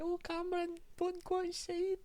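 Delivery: put-on very high, slow falsetto old woman; squeaky old Scottish granny impression. Imitating friend's parent, falsetto old Scottish woman. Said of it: "like an old Scottish woman".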